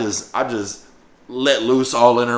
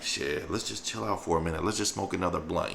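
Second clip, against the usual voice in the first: low hushed; 6 semitones lower, 13 dB quieter than usual. Retold or imitated. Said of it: "So I'm like".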